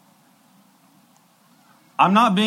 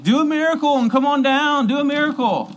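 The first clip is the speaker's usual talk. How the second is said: much higher, loud sing-song jeer; mocking taunt; 12 semitones higher, 4 dB louder than usual. Mockers at the cross jeer at Jesus in a high taunt. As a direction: taunt him like a schoolyard bully. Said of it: "They're all mocking him".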